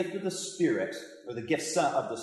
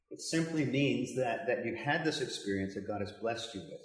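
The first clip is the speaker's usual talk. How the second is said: normal talking voice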